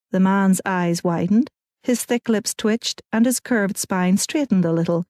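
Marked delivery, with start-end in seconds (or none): none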